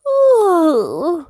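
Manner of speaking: very high, yawning piglet voice; Peppa. A narrator's reading. Peppa at evening missing puddles, high yawny piglet. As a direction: open with a yawny ooh, then high child voice. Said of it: "Peppa yawned".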